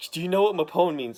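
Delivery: imitating a loud insistent question. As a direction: demand the answer urgently. Ben presses Elektra.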